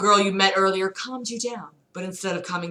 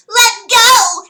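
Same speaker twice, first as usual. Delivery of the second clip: shrill scream, much higher and louder; cry out; 12 dB louder than usual. Acted. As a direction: scream to be let go. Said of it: "you cried".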